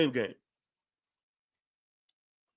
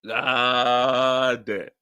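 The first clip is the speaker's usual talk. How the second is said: lower, dismayed god damn; stunned reaction; 5 semitones lower, much slower than usual. Retold or imitated. Father hit hard by his son's words, low stunned voice. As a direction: let it land like a gut punch, low. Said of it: "I'm like".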